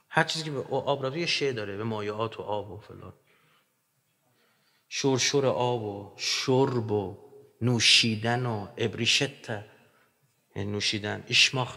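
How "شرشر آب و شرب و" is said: شرشر said slowly with a long hissing ش; drawn-out sh. Shown as a demonstration. Teacher linking the ش sound to water, slow hissing sh. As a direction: draw out the ش in شرشر like running water. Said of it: "حرف شین با آب گره خورده است".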